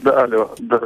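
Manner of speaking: normal talking voice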